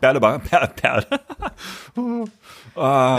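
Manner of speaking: giggling while trying to say the name; laughing. Real, not acted. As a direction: stumble over the word through laughter. Stumbling over the name Berlebach.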